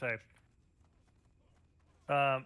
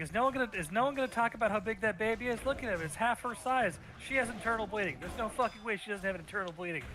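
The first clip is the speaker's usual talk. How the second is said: higher, faster incredulous rant; 7 semitones higher than usual. Retold or imitated. TikTok reactor gushing about the huge baby, higher and faster. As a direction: riff on it as an outraged TikTok reactor. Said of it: "And he's like".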